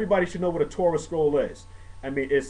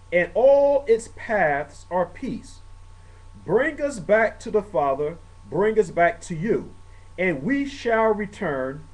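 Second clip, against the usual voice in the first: slow, raised solemn prayer reading; slow solemn English prayer; 3 semitones higher, 3 dB louder, slower than usual. Acted. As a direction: read the prayer out with reverence.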